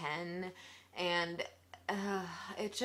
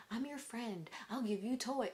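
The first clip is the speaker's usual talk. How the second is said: soft, higher-pitched fake friend voice; mock friendly reassurance; 4 semitones higher, 4 dB quieter than usual. Old man talking to this little boy, mock friendly. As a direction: imitate a creep coaxing a child, softly. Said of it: "trying to ensure him that".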